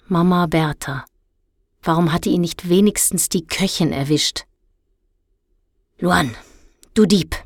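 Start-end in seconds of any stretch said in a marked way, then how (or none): 6.0-7.4 icy, restrained rebuke; eisiges Anherrschen. A narrator's reading. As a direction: accuse him coldly, with little volume. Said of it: "herrschte ihn Frau Bertowa mit eisiger Stimme an". (eisige Stimme, verhalten herrisch)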